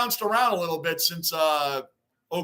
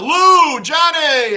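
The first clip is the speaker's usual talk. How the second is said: much louder and higher, calling names; excited call-out; 7 semitones higher, 11 dB louder, much slower than usual. Real, not acted. Host calling out to his viewers, loud and excited. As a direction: shout the names across the room.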